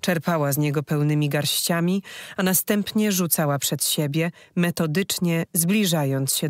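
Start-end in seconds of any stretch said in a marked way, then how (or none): none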